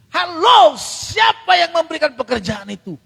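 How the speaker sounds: high, loud "Halo" calling the flock to account; indignant preacher's outcry; rebuking shout. Real, not acted. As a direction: jolt them awake with the question. Preacher confronts the congregation.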